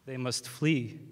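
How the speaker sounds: slow, sing-song limerick recital; hammed-up poetry reading; reciting a poem. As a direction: deliver the verse slowly, like a mock-grand poet.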